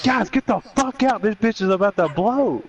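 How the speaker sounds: hammed-up panicked shout; urgent high yell. Brother warning coworkers that the tank will blow, high urgent yell.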